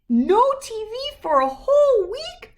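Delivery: high, loud cub voices in disbelief; shocked cubs. Acted. Cubs shocked at losing TV for a week, high disbelief. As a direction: cry it out as stunned little cubs. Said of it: "said the shocked Cubs".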